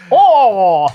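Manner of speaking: loud, imitating a Japanese "oh"; Japanese accent.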